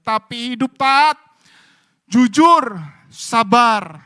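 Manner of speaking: loud, high impassioned sermon; rally the congregation to look to God; full-force preaching. Acted. Preaching continues. (khotbah berapi-api dengan suara keras)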